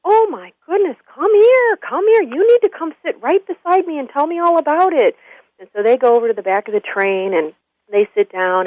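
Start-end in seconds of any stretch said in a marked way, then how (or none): 0.0-5.2 put-on high, fussing, welcoming old man's voice; sympathetic old man. Old man invites the crying drunk to sit, sympathetic high voice. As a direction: beckon someone over with pitched-up concern. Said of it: "the old man says".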